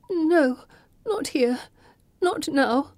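distraught denial. Acted. Pippa distraught, denying the fit.

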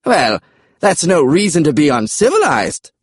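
high comic dinosaur voice; high-pitched dinosaur retort; dinosaur Charles. Acted. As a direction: answer back in a high creature voice. Dinosaur Charles answers back.

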